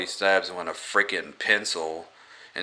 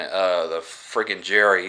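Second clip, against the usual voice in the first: ordinary talk, nothing marked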